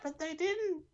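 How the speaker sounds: very high falsetto commentator; Cole impression. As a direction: squeal it in a mock high voice. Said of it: "And Cole was like".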